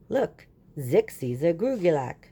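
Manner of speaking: lightly voiced alien, pointing out eagerly; a hint of an eager alien; alien excitement. Alien Zik points out animal.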